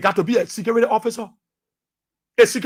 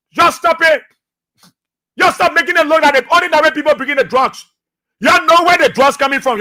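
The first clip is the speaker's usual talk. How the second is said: raised, forceful insistence; angry call to stop blaming people; loud demand; 5 semitones higher, 3 dB louder than usual. Real, not acted. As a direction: tell them to stop, loud and firm.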